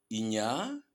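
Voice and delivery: "inya" with the final A drawn out; A final allongé. Shown as a demonstration. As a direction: stretch the end of "inya": "inyaa". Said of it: "on va prononcer plus long". (« inya » avec le A final bien allongé)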